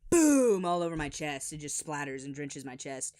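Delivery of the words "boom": very loud, high exploding boom; booming impact. Retold or imitated. Chimichanga hitting the boy's chest, loud explosive boom. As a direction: explode on the word.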